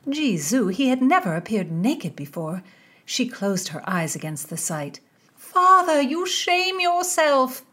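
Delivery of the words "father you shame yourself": high, loud cry of despair. A narrator's reading. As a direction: reproach him in anguish. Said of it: "Lettice despaired".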